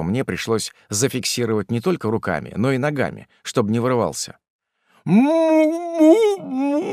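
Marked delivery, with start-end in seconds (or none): 5.0-6.8 high closed-mouth mumbling; muffled refusing moan. A narrator's reading. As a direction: moan through clenched jaws. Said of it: "отрицательно промычал писец".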